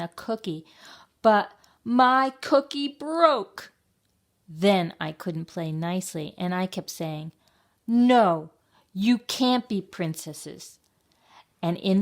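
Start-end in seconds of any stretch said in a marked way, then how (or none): 1.2-3.7 high, loud, drawn-out complaint; dismayed wail. A narrator's reading. Child at lunch with Sasha, dismayed wail. Child narrator's cookie breaks. 7.9-10.8 put-on high, emphatic child's objection; loud NO, then slow insistence; bossy refusal. A narrator's reading. Child who couldn't play nicely with Sasha, bossy refusal.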